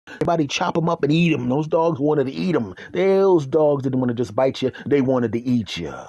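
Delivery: ordinary talk, nothing marked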